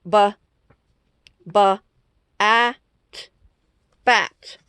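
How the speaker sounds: "Ba" then "at" then "bat" blended; sounding out bat. Shown as a demonstration. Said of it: "sounding out bat".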